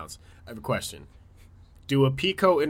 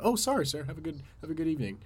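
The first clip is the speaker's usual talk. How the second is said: higher, softer mock-polite apology; meek apology; 4 semitones higher, 7 dB quieter than usual. Excusing himself to whoever stepped on his shoe, meekly apologetic. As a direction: back off meekly in a high, soft voice.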